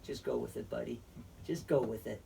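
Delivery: low, soft, leaning-in murmur; hushed urging. Retold or imitated. Jesus leaning close to tell John to play along, low and soft. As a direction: quietly coax him to play along. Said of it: "he's just like".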